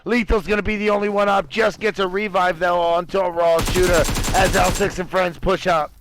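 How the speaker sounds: energetic esports caster voice; play-by-play. Acted. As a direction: call the fight live, fast and keen. Live casting of a PUBG match.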